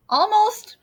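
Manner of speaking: high, loud and eager. Acted. Larry urges the reader to shake, high and eager. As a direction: urge them on excitedly.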